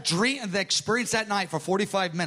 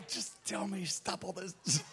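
urgent hushed plea; 12 dB quieter than usual. Retold or imitated. Pressing Bob for the vision.

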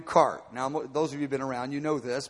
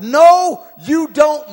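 sudden loud, high refusal; God's booming no; 11 semitones higher, 14 dB louder, much slower than usual. Acted. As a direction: cut him off with a thundering no. God refuses Moses, sermon retelling.

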